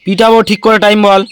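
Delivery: loud, high-pitched parental telling-off; stern scolding. Real, not acted. Parent threatens child over time. (চড়া গলায় বকুনি)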